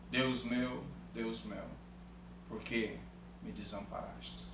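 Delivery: low, soft, sinking scripture quote; subdued, halting reading of Christ's words; hushed lament. A narrator's reading. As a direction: let the cry fade away, phrase by phrase. Translates Christ's cry of abandonment.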